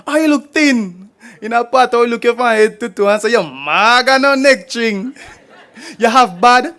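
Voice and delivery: lively rhythmic Patois poem recital; performance poetry. Acted. Poet performing Patois poem to audience, rhythmic and lively. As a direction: perform it to the crowd with bounce.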